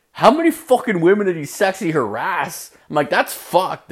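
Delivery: shocked outrage. Retold or imitated. Comic reacting to his boss, high appalled outrage. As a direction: ask it scandalized.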